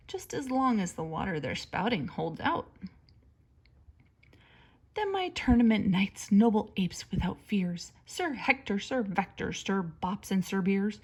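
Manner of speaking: ordinary delivery, nothing marked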